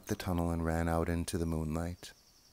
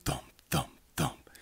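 loud, separate thumps; imitated knocking; 8 semitones higher, 5 dB louder than usual. A narrator's reading. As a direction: voice heavy knocks on a door. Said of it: "The badger knocked at his door".

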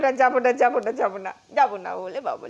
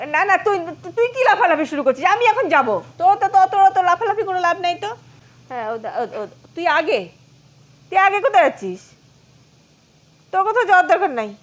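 higher, louder, fast playful scold; mock scolding; 6 semitones higher, 5 dB louder, faster than usual. Real, not acted. Owner scolding the jumping pet, mock scold.

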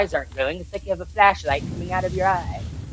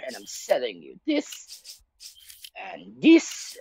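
merchant voice; 3 semitones lower, 6 dB quieter, slower than usual. Retold or imitated. Imitating the merchant.

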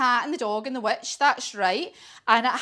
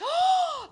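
high theatrical intake of breath; startled gasp. Acted. Storyteller sees dragon confronted by beast, startled gasp. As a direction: gasp in pretend shock.

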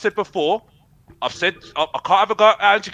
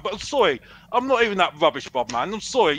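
usual speaking voice

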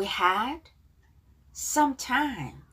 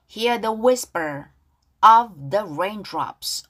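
louder, very slow, stretched words; slow, drawn-out model reading; 4 dB louder, much slower than usual. Shown as a demonstration. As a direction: lay it out gently for students to echo. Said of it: "가사 발음 따라볼게요". (크고 아주 느리게 늘여서)

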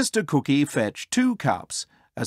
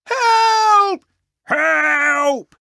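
very high, loud, drawn-out scream; hammed-up piercing pig shriek; desperate cry for help; 8 dB louder than usual. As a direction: squeal for help in a panic. Pigs bang on door, fleeing.

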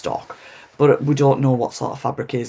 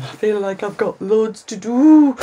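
high, rising sing-song; odd sing-song worry; 8 semitones higher, 5 dB louder than usual. Real, not acted. Vlogger fretting over a long to-do list, sing-song. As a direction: let the voice climb in playful overwhelm. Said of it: "I don't know why I'm saying it like that".

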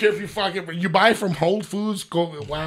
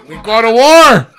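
laughing war cry; 3 semitones higher, 13 dB louder than usual. Retold or imitated. Scarface quote, laughing.

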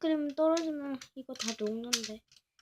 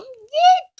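gleeful cheer; 13 semitones higher, 17 dB louder than usual. Acted. Cheers at infinite invisibility.

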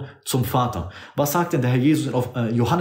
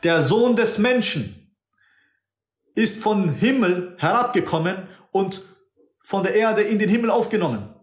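voicing Jesus; 7 semitones higher than usual. Preacher quoting Jesus about the Son of Man, raised. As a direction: announce it with lifted reverence. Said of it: "Da sagt der Herr".

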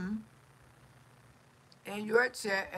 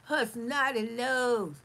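mocking haters. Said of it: "they come out here and start, start more cringes".

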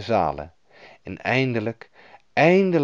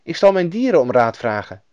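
put-on raised, helpful child's voice; higher-pitched young robber girl; 6 semitones higher, 4 dB louder than usual. A narrator's reading. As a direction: play a friendly little girl offering help. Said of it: "zei het roversmeisje".

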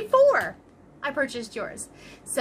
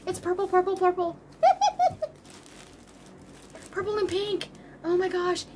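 much higher, gushing delight; thrilled squeal; 7 semitones higher than usual. Real, not acted. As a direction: squeal over the favourite yarn. Finds her favourite yarn.

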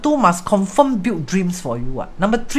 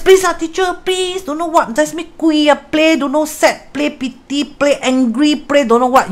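higher, begging mock plea; imitated pleading scammer; 8 semitones higher than usual. Retelling how the caller begged teacher, higher mock pleading. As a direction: whine and beg like a desperate caller. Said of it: "Then the person said".

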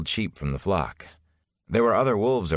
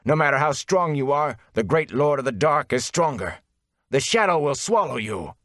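raised, menacing prophecy; menacing threat; 8 semitones higher than usual. Acted. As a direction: threaten him with dark glee. Dark friend threatens Rand.

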